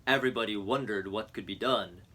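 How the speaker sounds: usual speaking voice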